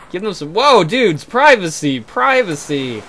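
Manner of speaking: firm call. Acted. Telling characters off.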